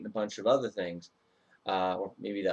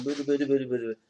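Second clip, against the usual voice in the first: a playful sung "boo-doo" for the pedal rhythm; imitated pedalling noise; 4 semitones higher, much faster than usual. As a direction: hum the bike's rhythm, a little higher. Imitating cyclist riding along.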